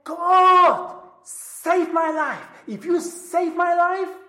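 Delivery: loud, pleading cry to God. Retold or imitated. A doomed journalist crying to God, loud pleading. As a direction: call on God for rescue, loud. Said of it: "he cried out to God and said".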